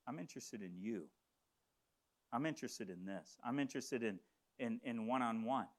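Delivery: low, soft, heartfelt aside; quiet sincerity. Real, not acted. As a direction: drop the voice and mean it personally. Sincere turn to the congregation.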